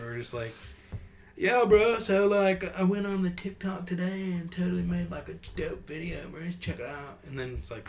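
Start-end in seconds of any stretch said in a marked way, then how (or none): 1.3-7.2 high, loud modern social-media bro; hammed-up dude voice, easing down toward the end; dumb bro. Friend mocking how people talk nowadays, dumb bro voice. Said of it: "sound more dumb as we get".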